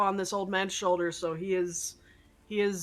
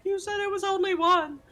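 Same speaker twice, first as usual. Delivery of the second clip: hysterical cry; 11 semitones higher, 4 dB louder than usual. Pretend. Player character crying hysterically.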